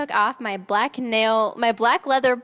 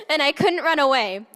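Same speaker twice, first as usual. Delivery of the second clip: usual speaking voice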